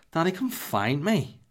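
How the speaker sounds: high, slow singsong child imitation; drawn-out mock toddler call. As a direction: play a little one inviting Dad to seek. Voices daughter calling from bed.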